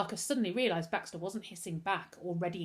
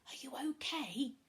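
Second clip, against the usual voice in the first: soft, high, hushed question; gentle concern, pitched up; worried; 6 semitones higher, 6 dB quieter than usual. A narrator's reading. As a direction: check on a friend quietly. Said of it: "looking worried".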